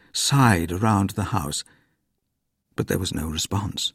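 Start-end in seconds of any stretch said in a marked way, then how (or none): none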